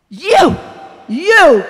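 loud, high gasping cry; gasped shout. A narrator's reading. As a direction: gasp it out in outrage. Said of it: "gasped the ghost".

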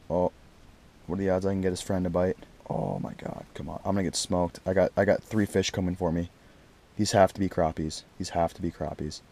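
low, soft, intent murmuring; hushed anticipation. Real, not acted. Angler coaxing the fish's friend to bite, hushed. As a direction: talk low to yourself while waiting.

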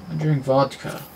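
ordinary talk

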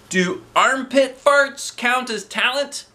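put-on high, slow boy voice; cheeky boy. A narrator's reading. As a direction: ask it innocently. Boy Ryan asks, voiced.

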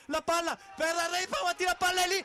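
loud, rising save call; excited call. Real, not acted. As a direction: shout the big save. Double save in the box.

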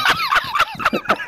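high-pitched cackling laugh; helpless high laughter; laughing hard. Real, not acted. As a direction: burst out laughing, high and loud. Friend laughs at haircut story.

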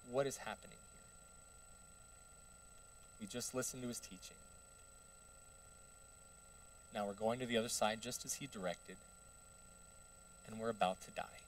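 soft, subdued bewilderment; quiet dismay. Acted. Disciples facing death in the boat, quietly bewildered. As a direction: say it low and hushed, stunned.

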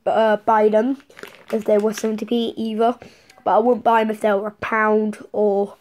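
ordinary talk

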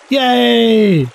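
high, excited little cheer; kids' cheer. A narrator's reading. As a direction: cheer like a bunch of thrilled kids. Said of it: "cry the kids".

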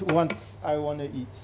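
ordinary talk

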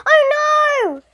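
loud, high-pitched cry of dismay; alarmed schoolboy shout. A narrator's reading. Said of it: "Stephen shouted".

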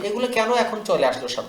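ordinary talk

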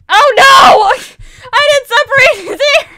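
high, very loud wail of dismay; distraught wail. Real, not acted. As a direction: cry out in over-the-top despair. Distraught over a building mistake.

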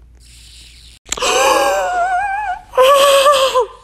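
long high shrieking gasp; loud high stunned gasp; shocked gasp. Real, not acted. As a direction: gasp as if the impossible happened. Trade just accepted.

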